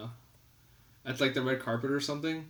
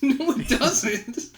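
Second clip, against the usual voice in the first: slow, laughing denial; laughing through the words; 11 semitones higher, 8 dB louder, much slower than usual. Real, not acted. Laughing during a playful argument.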